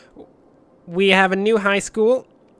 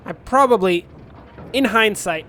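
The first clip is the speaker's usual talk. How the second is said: ordinary talk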